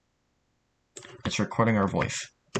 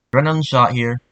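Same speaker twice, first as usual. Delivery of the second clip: louder, higher in-game callout; voice line recording; 4 semitones higher, 9 dB louder, faster than usual. Shown as a demonstration. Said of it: "the voice line you just recorded".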